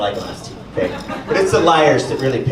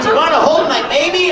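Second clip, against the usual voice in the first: put-on sister offering baby; 11 semitones higher, 4 dB louder than usual. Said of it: "and she's like".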